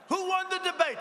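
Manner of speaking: high shouted question to the crowd; calling out. Real, not acted. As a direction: call it out to the crowd. Calls out to crowd.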